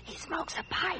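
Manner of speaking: hushed secret whisper to her sister. Real, not acted. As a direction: breathe it so the neighbor can't hear. Aside so neighbor won't hear.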